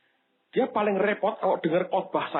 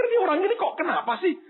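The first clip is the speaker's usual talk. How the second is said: puzzled laugh; 12 semitones higher, 3 dB louder, faster than usual. Retold or imitated. Pastors puzzled, laugh heard.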